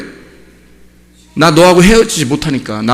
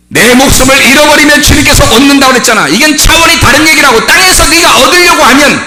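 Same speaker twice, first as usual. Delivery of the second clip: high, loud, driving sermon shout; full-force preaching; 11 semitones higher, 5 dB louder than usual. Real, not acted. Preacher explaining losing life to gain it, full-force preaching. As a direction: proclaim it urgently to the congregation.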